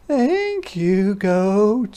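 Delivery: high, bright thank you; high grateful hedgehog. A narrator's reading. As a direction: thank him as a tiny hedgehog.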